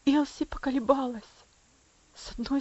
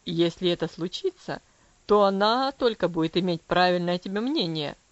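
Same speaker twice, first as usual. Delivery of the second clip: low, cold father's voice; coldly; 5 semitones lower, 6 dB louder than usual. Acted. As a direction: answer her icily, pitched low. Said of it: "холодно ответил он". (холодно, низким голосом отца)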